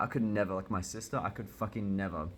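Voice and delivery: usual speaking voice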